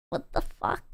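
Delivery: whispered, under the breath; shocked whisper. Real, not acted. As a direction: breathe it out in disbelief. Stunned reaction to chat message.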